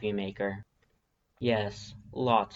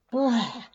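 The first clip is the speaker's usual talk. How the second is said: a sudden high spluttered blah; startled sputter; 11 semitones higher, 3 dB louder than usual. Acted. Woken by a paw on the mouth, sputtering. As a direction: spit out the paw with a jolt.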